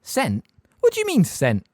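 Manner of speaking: a touch of brisk disbelief; clipped, demanding; sharp teacher. A narrator's reading. McGonagall questioning.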